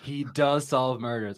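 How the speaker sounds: ordinary talk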